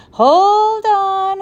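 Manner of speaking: high, loud call to halt; urgent stop. Acted. Professor stopping the boys' plan, high loud interruption. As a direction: throw up a hand and stop them.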